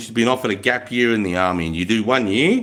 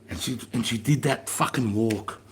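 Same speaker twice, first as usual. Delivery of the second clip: usual speaking voice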